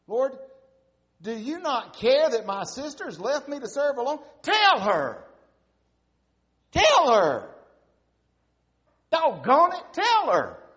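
very high, very loud furious demand; Martha's full-blown angry outburst; sharp demanding tone. Shown as a demonstration. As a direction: scream it at the Lord, exasperated. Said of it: "Or it could be".

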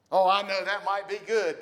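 mock churchgoer. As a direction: play the churchgoer brushing it off.

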